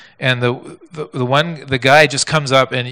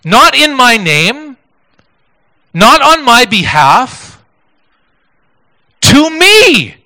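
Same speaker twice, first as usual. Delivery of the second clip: slow, much louder and higher, punching each phrase; emphatic contrast; 11 semitones higher, 11 dB louder, much slower than usual. Shown as a demonstration. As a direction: hammer the correction, peaking on to me. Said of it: "he says".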